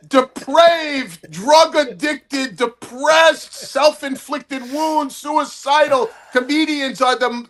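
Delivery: high-pitched, emphatic comic rant; Gary Marshall. Retold or imitated. Mentor rants to comic about miserable comedians, high-pitched impression.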